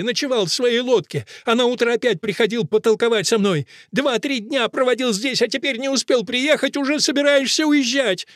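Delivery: high, reproachful outcry; Fusia. A narrator's reading. Fusia reproaches Akelina for leaving early, crying high. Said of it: "кричит Фуся".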